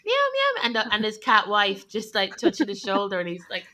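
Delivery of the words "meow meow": high, singing meow imitation; sung cat meows. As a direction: meow the tune like a crying cat. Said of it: "but in meow form".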